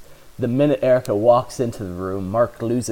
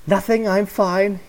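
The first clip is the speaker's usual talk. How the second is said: lightly breathy, higher pitch; 10 semitones higher than usual. Acted. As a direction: say it on an airy breath. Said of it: "he says in a breathy voice".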